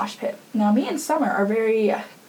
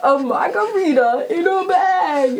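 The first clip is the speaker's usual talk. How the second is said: incredulous laugh; 9 semitones higher than usual. Retold or imitated. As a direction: laugh through the question like it's absurd. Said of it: "and he's like".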